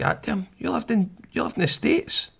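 high, light incredulous question; impressed surprise. Retold or imitated. Workmate learns his colleague lived abroad, high impressed surprise. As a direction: ask in wonder, pitched well up.

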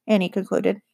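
usual speaking voice

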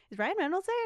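high, loud, eager question; retold excited question. Friend asking about a celebrity at the game, imitated excitedly.

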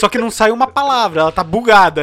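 animated. Real, not acted. Describing a frozen person.

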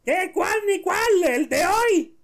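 very high, loud comic retort; high-pitched drunken exasperation; exasperated drunk owner. Acted. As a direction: yell back at the duck in a squeaky drunk voice. Drunk owner snaps back at duck.

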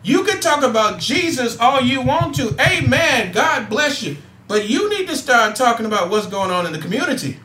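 impassioned call to action; preaching. Acted. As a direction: fire up the audience like a Sunday sermon. Rises into preaching to listeners.

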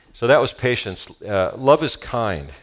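ordinary delivery

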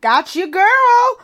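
high, loud, drawn-out cheer; playful support. Real, not acted. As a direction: sing out your backing, stretched long. Playful show of support for Lauren.

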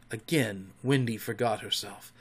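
plain reading voice

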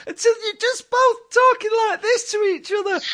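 squeaky pitch pushed way up, competing to be highest; ridiculously high voice. Shown as a demonstration. To his co-host, showing how the two of them argued, in a ridiculously high voice. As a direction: go as high as your voice will go, indignant. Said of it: "the higher and higher your voices go".

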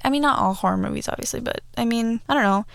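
ordinary talk, nothing marked

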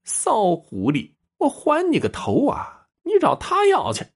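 high-pitched, acted outrage of an insulted wife; indignant retort. A narrator's reading. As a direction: throw the insult back in a high voice, opening drawn out. Widow hurls insult back at rival.

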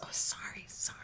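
hushed apology; sorry under the breath; whispering. Real, not acted. Daughter upstairs, whispered apology.

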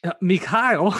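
high, loud incredulous echo of Mikhail; mock disbelief at the stranger's name; amused surprise. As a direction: repeat the name like you can't believe it. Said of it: "echoes the mock name".

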